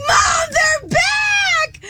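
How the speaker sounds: high, drawn-out scream of panic; panicked shriek. Retold or imitated. On the phone, shrieking to mom as spiders pour out. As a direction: cry out to mom in real terror.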